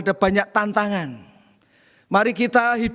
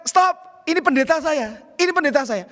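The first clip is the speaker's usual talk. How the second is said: retold shout; 6 semitones higher than usual. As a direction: call out to hold the angry man back. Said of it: "tiga anak muda itu mengatakan". (tiga pemuda menghentikan orang marah, teriakan yang diceritakan ulang)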